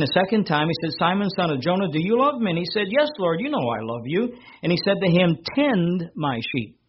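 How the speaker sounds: lifted, ringing scripture voice; scripture reading. Acted. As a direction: proclaim the Gospel to the congregation. Reads verse sixteen aloud.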